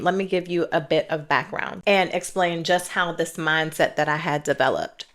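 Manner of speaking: usual speaking voice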